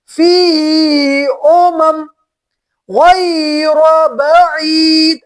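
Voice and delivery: stopped endings, each word slow and drawn out; reciting with pauses. Shown as a demonstration. Teacher showing the pausal reading of the verse. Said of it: "जब इसको वक्फ करेंगे तो पढ़ेंगे".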